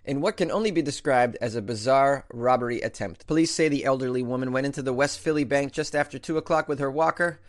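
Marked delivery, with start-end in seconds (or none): none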